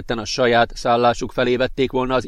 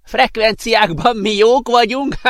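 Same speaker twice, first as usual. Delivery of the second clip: high, loud, drawn-out character voice; slow, high boast breaking into laughter; laughing professor; 10 semitones higher, 4 dB louder, slower than usual. A narrator's reading. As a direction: voice an amused professor, big and high. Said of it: "Nevetett ezúttal még nagyobbat a professzor".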